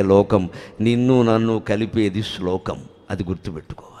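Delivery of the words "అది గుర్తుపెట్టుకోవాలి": soft confiding whisper. Real, not acted. Speaker confiding a lesson to the audience, whispered. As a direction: breathe it like a secret.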